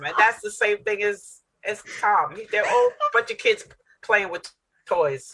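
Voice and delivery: ordinary delivery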